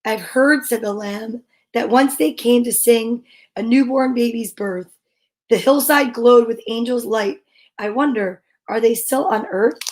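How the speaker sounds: gentle picture-book read-aloud; storybook reading. Acted. Reading a picture book about angels to listeners, gentle.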